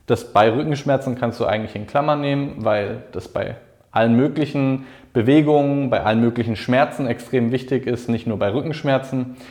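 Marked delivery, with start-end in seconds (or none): none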